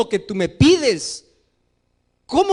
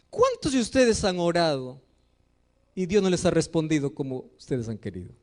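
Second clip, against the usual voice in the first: usual speaking voice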